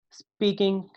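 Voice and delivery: Speaking, strong then weak; trochaic stress. Shown as a demonstration. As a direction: stress स्पी and let king go weak. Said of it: "first one stress वाला होगा".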